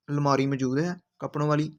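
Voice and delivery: ordinary delivery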